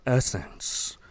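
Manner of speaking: perfume ad voice. Pretend. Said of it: "make it sound like one of those".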